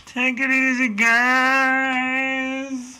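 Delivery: high, held-out farewell call; drawn-out sign-off. Pretend. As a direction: stretch the last word out long and high. Drawn-out sign-off echoing co-host.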